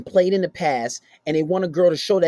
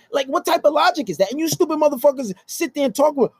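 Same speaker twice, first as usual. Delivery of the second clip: ordinary talk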